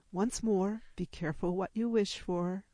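usual speaking voice

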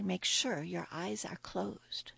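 slow, whispered warning; hushed warning. Acted. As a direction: whisper it slowly as a solemn caution. Queen's final warning to Maria.

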